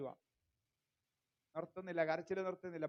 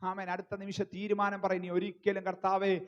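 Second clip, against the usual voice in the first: loud, insistent sermon delivery; forceful preaching; 8 dB louder than usual. Real, not acted. Preacher urges the congregation to renew their resolve, loud forceful preaching. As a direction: drive it home at full preaching volume.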